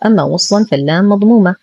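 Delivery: ordinary delivery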